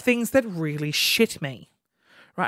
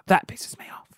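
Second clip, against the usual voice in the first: whispered complaint; 5 dB quieter than usual. Real, not acted.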